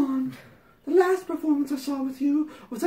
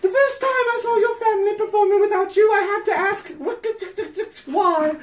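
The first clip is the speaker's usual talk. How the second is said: hammed-up letter voice; 6 semitones higher, 4 dB louder than usual. Reading the letter to Patty, hammed-up prim voice. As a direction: read it like a sanctimonious fan pouring her heart out. Reads fan letter aloud.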